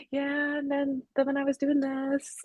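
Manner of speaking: high, soft, offhand imitation; casual name-dropping. Co-host mimicking Eddie's endless stories, soft casual drone. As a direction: ramble on lightly, imitating a casual storyteller. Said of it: "he's just so casually said".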